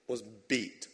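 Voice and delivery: normal talking voice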